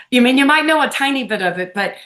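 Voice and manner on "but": normal talking voice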